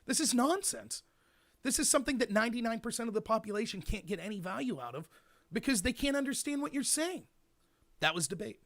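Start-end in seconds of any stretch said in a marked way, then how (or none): 0.0-7.2 raised-pitch incredulous protest; baffled outsider. Acted. Outsider reacting to a debate round, incredulous. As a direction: object in disbelief, pitch climbing as you go. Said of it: "as an outsider, you would go in and go".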